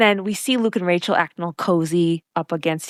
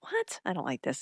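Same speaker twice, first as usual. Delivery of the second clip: hushed dismay; 13 dB quieter than usual. Retold or imitated. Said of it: "And I was like".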